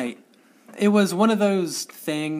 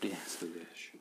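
soft whispered aside; unvoiced whispered joke; 7 semitones lower, 16 dB quieter, slower than usual. Real, not acted. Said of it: "whispered aside".

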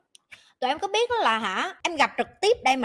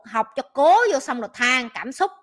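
ordinary talk, nothing marked

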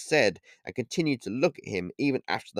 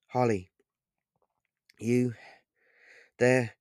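broken words between gasps; rasped and halting, can't find words; out of breath; slower than usual. A narrator's reading. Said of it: "did not make any sense as he spoke and rasped".

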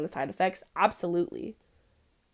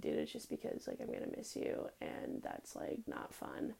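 soft whispered confession; tender whisper; 15 dB quieter than usual. Retold or imitated. She tells her friends she'll miss them, whispered. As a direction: whisper it like hard-to-say truth. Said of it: "And I'm like".

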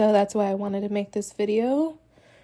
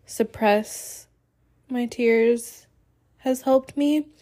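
normal talking voice

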